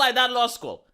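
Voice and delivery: usual speaking voice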